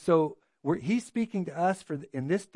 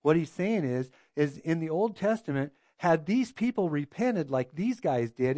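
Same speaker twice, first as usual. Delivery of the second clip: normal talking voice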